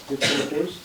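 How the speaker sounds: normal talking voice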